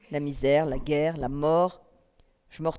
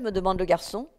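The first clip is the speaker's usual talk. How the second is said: plain reading voice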